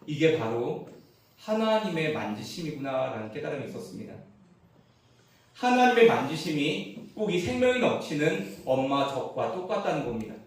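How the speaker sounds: ordinary talk, nothing marked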